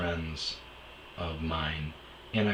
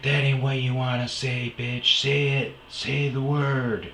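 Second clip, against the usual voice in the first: taunting ex; 7 semitones higher, 5 dB louder than usual. Retold or imitated. Ex taunting in fight.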